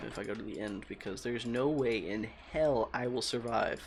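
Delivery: ordinary talk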